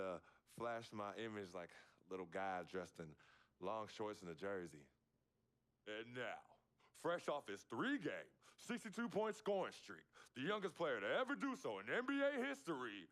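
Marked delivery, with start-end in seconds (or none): 5.9-13.1 booming, drawn-out arena announcer intro. Retold or imitated. Boyhood friend playing announcer, booming arena intro. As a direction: hype the crowd like a stadium PA. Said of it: "he would act as an announcer".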